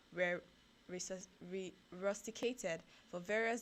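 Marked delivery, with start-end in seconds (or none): none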